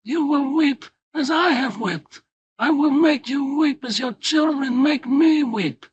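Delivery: high, loud hoarse cry of grief; grieving father shouts hoarsely at the acquitted boys. A narrator's reading. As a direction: scream the curse hoarsely across the aisle. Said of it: "shouted hoarsely".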